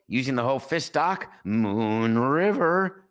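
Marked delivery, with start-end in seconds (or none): 1.4-3.0 Fletch quote. Retold or imitated. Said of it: "You hear me say".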